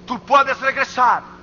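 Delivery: impassioned pulpit exhortation; forceful preaching appeal. Real, not acted.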